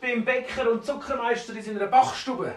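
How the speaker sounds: high-pitched, indignant dwarf; scolding. Acted.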